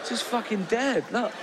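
can't believe it's gone; shocked disbelief. Acted. Comedian to the audience on finding the imagined baby dead, high shocked disbelief.

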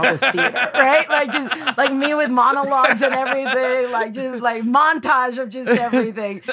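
giddy laughing rush; laughing excitement. Real, not acted. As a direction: gush through the memory with laughs. Excited montage description, laughing.

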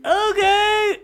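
very high tiny-creature okay; talking mushroom. Acted. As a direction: agree in a squeaky little mushroom voice. Mushroom agrees to hop in pocket.

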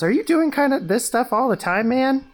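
high, astonished question; incredulous. Acted. Teen asking shapeshifter about this stuff, incredulous.

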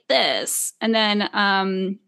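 normal talking voice

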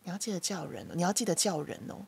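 put-on mother quietly prompting her child to greet elders; 妈妈在耳边小声提醒; soft reminder in ear. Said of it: "她都会小声的在我耳边讲".

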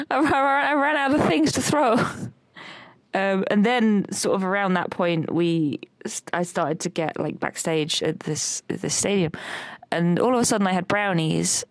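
usual speaking voice

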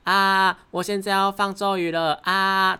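high, stiff rookie spell call; lightly mock a wooden anime heroine; bad acting. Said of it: "就是有一点".

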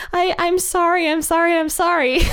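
put-on high, pleading kid voice begging sorry; whiny child apology. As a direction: play the kid begging to be let off. Child pleading after tantrum.

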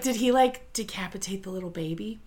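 soft, voiceless, fast whisper; horrified whisper. Retold or imitated. Thinking aloud to a friend, a shocked whisper. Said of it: "Well, I was like".

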